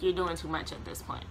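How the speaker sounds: normal talking voice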